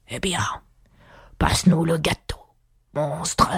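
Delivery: rough, airy beast voice; weary lion. A narrator's reading. Lion demanding cake from Alice, weary and rough. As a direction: give the order in a sleepy lion's croak.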